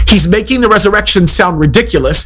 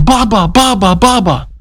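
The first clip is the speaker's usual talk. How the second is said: put-on two-year-old voice; 7 semitones higher, slower than usual. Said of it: "he says".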